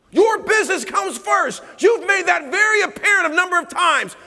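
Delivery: high, loud, slow shout; adamant shouting wife. Retold or imitated. Wife confronting her husband about choosing business, shouting. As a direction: yell it like a fed-up wife. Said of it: "she gets more adamant".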